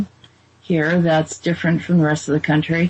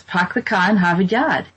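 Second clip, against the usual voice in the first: R-less Boston "pahk the cah"; Bostonian accent. Shown as a demonstration. Repeating it for a puzzled listener, Bostonian accent. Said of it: "that's how they say it".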